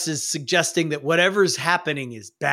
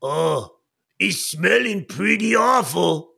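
slow goblin voice, drawn-out complaint; disgusted goblin; slower than usual. Acted. Goblin beside the merry-go-round, disgusted. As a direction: groan it out at a stink. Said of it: "and then he goes".

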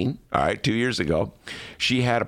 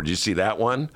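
ordinary talk

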